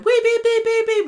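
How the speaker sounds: very high, loud wee beep siren noise; imitated siren. Mimicking the police siren when the swat team arrive, very high. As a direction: squeal the siren sound out. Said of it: "and then".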